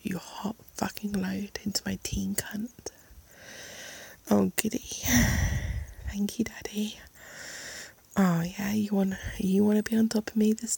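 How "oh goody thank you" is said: normal talking voice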